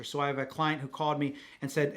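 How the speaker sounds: ordinary talk, nothing marked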